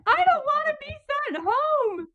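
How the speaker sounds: very high mock wail; acted whiny protest. Patient retelling her dread of being sent home, high mock wail.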